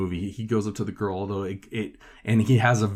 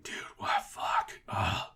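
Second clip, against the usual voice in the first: whisper-shout; 9 dB quieter, slower than usual. Retold or imitated. Frustrated self after chickening out.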